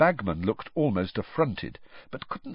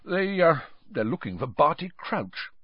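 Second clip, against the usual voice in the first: nervous; 8 semitones higher than usual. A narrator's reading. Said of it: "looking suddenly nervous".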